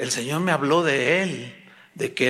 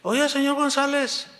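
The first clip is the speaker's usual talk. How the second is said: higher-pitched mock informant; tattling employee; 9 semitones higher than usual. Coworker telling señor González gossip, higher-pitched tattling. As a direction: call out to get the boss's ear. Acted gossip addresses the boss.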